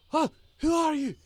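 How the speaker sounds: put-on loud, high Scottish-accented shouts; startled Scotsman shouting in alarm; bewildered cry with a thick Scottish burr; heavy Scottish accent. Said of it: "with this heavy accent".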